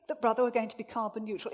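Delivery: normal talking voice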